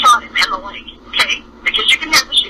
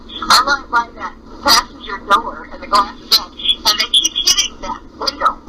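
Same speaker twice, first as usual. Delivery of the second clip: ordinary talk, nothing marked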